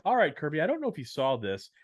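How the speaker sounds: usual speaking voice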